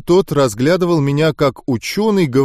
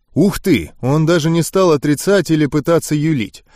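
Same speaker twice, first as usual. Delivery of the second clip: usual speaking voice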